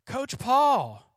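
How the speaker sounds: put-on high, loud child calling the coach; calling for help. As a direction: imitate a kid crying out for help. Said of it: "and go".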